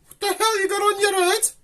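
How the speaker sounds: squeaky disbelief; high squeaky voice. Acted. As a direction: squeal it at the puppet. Teasing puppet in shower cap.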